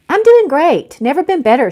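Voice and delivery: high, loud, falsely bright; forced cheerfulness. Acted. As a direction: oversell being fine with a forced smile. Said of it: "she lied, forcing a cheerful smile".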